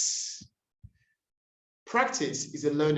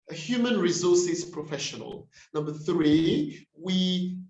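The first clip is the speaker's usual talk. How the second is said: ordinary talk, nothing marked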